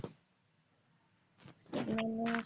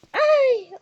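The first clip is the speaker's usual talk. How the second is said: screaming while falling; 16 dB louder than usual. Real, not acted. Nahulog na naman ako.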